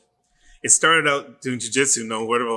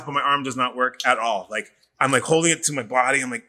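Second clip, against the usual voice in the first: ordinary talk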